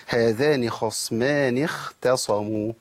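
هذان خصمان اختصموا said slowly, each letter clear; drawn-out model reading of هذان خصمان اختصموا; careful teacher demonstration; slow tajweed demo. Shown as a demonstration. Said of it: "الخطأ اللي بيقع فيه الناس".

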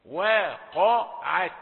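"وقعت" slowly, thin we then dark qo; light waw. Shown as a demonstration. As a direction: separate the syllables loudly, light w in "وقعت". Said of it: "واو رقيقة". ("وقعت" بواو رقيقة وقاف مفخمة)

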